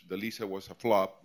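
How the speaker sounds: normal talking voice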